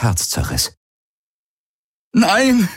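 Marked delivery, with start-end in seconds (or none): 2.1-2.7 high, anguished cry; tearful scream. A narrator's reading. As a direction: scream it through tears, trembling. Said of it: "schrie Garlin, weinend und zitternd". (weinend und zitternd geschrien)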